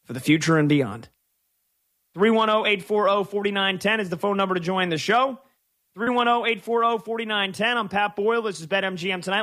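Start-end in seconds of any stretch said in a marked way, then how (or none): 2.1-9.4 higher, upbeat announcer read of the number; radio call-in plug. Acted. Radio host plugging the call-in line, bright announcer read. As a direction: sell the phone line like a promo.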